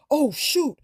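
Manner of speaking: imitating a shocked dad realizing his baby got out; startled oh shoot. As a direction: caught completely off guard, blurting it out. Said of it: "like that".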